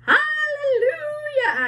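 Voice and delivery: very high, slow, loud cry of joy; joyful shout. A narrator's reading. Aunt Spiker shouts for joy at the huge peach, very high. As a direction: whoop it out in delight. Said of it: "Aunt Spiker shouted".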